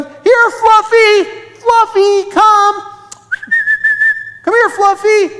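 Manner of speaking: high, loud call for a lost dog; shouting. A narrator's reading. Children calling a lost puppy by the bushes, shouting. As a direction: call far across the park, high and drawn out. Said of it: "they started shouting".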